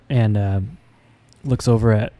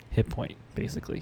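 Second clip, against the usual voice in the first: whispering; 13 dB quieter, faster than usual. Real, not acted. Quiet side remarks.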